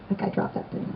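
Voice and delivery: very soft, voiceless murmur; indifferent aside. Retold or imitated. Former self noting someone's dropped thing, indifferent murmur. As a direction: mutter it idly under the breath.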